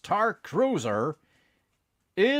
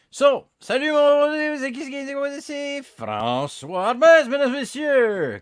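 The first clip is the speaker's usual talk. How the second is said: loud high showman intro; hyped host greeting; 12 semitones higher, 9 dB louder than usual. Acted. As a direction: announce yourself like a game show host. Hyped French show greeting.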